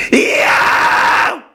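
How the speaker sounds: very high, loud shrieking yo; high false chord scream. Shown as a demonstration. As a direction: tear out a piercing high scream. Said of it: "That'd be your high".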